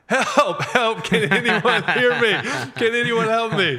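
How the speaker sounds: high, loud cries for help, with a laugh in them; half-laughing mock cry for help; retold desperate yell, slightly amused. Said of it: "he began to cry out".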